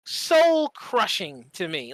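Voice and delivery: usual speaking voice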